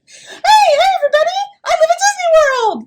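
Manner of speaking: Mickey Mouse impression. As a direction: chirp it like a cheerful cartoon mouse. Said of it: "Mickey Mouse".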